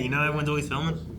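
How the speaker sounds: ordinary talk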